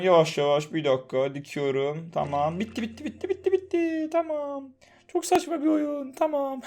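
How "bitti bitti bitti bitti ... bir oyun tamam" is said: soothing high voice. Acted. Ağlayan hastayı yatıştırıyor.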